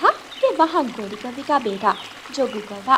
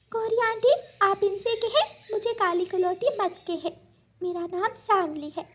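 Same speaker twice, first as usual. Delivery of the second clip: put-on hurt little girl; 9 semitones higher than usual. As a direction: ask not to be called names. Little bird protests name-calling.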